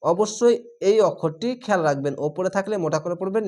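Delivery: ordinary talk, nothing marked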